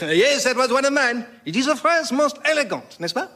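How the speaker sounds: put-on high, proud French accent; outrageous French accent. Voltaire boasting about his phrase in a French accent. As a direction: preen over the phrase, very French. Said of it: "in outrageous French accent".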